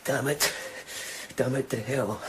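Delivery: soft despairing curse under breath; low, soft self-reproach; bitter muttering. Acted. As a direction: curse yourself quietly. Alone, despairing after failure.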